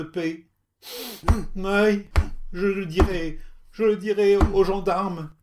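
crying out in pain. Acted. Beaten old woman crying out to her attacker. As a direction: wail and threaten through sobs. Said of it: "entre des soupirs et des sanglots".

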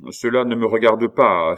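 loud, firm, dignified refusal. A narrator's reading. Pitou declines to give his men advice, dignified. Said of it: "avec une certaine dignité".

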